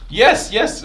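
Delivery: high, loud triumphant yes; excited yes. Real, not acted. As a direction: cheer it as the hit lands. Attack finally lands.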